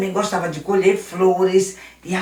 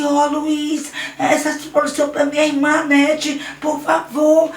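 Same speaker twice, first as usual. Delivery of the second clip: put-on higher small child's begging voice; Daniel begging the bully Luiz, higher child voice; little boy pleading; 8 semitones higher than usual. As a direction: plead timidly for the flowers.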